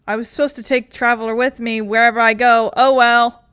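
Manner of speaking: loud, fast, comic self-scolding; mock dismay. Real, not acted. Streamer realizing they dropped the Traveler, loud mock dismay. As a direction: blurt it out, laughing at the mistake.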